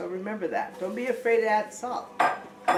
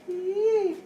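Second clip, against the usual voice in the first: excited yelp; 11 semitones higher than usual. Real, not acted. Excited cooking exclamation.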